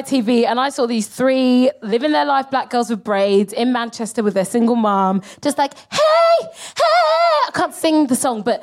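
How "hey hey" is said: high, drawn-out "hey"; mock pop-group greeting; bubbly TV greeting. Imitating a TV girl group's greeting.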